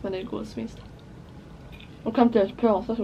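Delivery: chewing while talking; speaking between bites of food; mouth full. Real, not acted. Seen 0: bites snack before, holds bitten piece, eats again near 12s.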